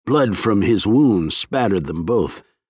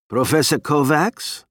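muffled voice; 6 semitones higher than usual. A narrator's reading. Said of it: "a muffled voice said".

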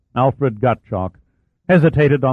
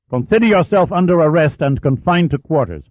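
louder, higher furious command; angry order; 6 semitones higher, 4 dB louder than usual. Acted. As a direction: bark the arrest. Captain arrests officer.

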